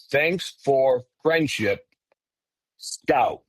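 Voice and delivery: slow, halting words with gaps between; broken speech. Retold or imitated. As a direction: say it slowly, word by separate word. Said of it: "It was broken a little bit".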